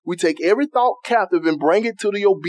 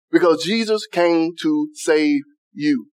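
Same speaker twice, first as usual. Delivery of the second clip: Because Jesus came to save you, drawn out, lower and slow, leaning on Jesus; slow right down and lower the voice; 4 semitones lower, slower than usual. Real, not acted. Preacher driving home to the church that Jesus came to save, slowly.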